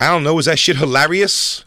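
loud thuggy ticket seller; street hustler impression. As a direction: hawk tickets like a pushy street guy. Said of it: "my impression of it used to be".

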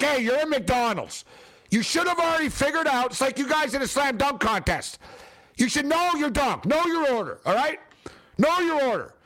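high-pitched exasperated scolding; exasperated rant. Retold or imitated. Ranting at slow customers.

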